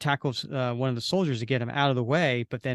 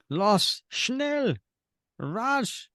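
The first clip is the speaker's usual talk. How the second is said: high-pitched German commands; eerie orders called out high; ghostly soldier calling orders in German; 8 semitones higher, much slower than usual. Acted.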